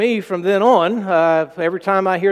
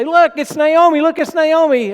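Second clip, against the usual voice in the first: high, loud eager calling out; excited call; 11 semitones higher, 7 dB louder than usual. Acted. Bethlehem townspeople greeting returning Naomi, loud excited calling. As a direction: townsfolk spotting an old friend and calling out. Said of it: "and they say".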